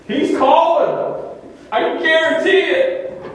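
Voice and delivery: slow insistent preacher's emphasis; emphatic proclamation. Acted. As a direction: declare it with certainty.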